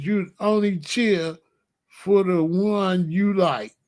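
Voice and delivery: ordinary talk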